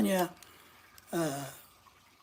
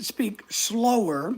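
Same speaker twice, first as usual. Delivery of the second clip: drawn-out, deliberately slower words; slowed down; much slower than usual. Real, not acted. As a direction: stretch the words out slowly. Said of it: "I do try to".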